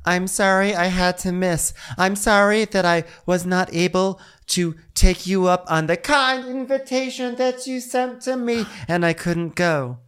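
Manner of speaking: high, slow, sugary apology; prim mock apology. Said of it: "She goes".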